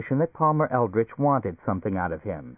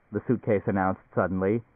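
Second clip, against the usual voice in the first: ordinary delivery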